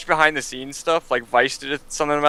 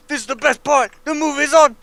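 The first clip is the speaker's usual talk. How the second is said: mock Jackie Chan dub; 12 semitones higher than usual. Friend imagines Jackie Chan dubbing his own movies, mock accent. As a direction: ham up a dubbed Jackie Chan. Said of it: "voice acted over all his movies".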